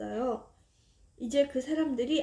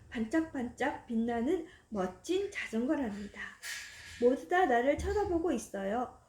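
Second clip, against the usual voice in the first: plain reading voice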